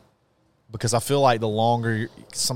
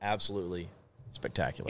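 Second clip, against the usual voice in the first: hushed; 3 semitones lower, 12 dB quieter, faster than usual. Real, not acted. Hushed praise of the nickname.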